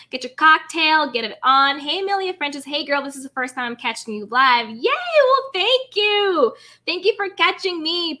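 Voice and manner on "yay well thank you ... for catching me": high, loud squeal of joy; thrilled thanks to a new viewer; excited yay. Real, not acted. Streamer thanking a first-time viewer, squealing happily.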